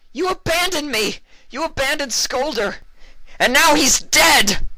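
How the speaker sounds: very high, loud, slowing on the last words; furious bark. A narrator's reading. Vinyl blames Mully for Skolder's death, furious shout. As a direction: bark it with full fury. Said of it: "Vinyl barked".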